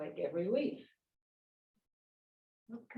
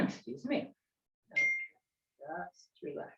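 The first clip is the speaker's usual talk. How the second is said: serene tai chi cues; 4 semitones lower than usual. Acted. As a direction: guide the movement gently.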